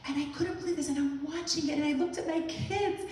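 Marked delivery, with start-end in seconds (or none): none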